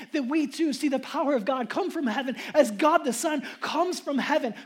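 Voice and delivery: ordinary talk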